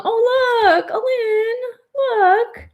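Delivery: high, tearful and very slow; choked up. Real, not acted. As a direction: voice breaking with emotion. Moved to tears by card.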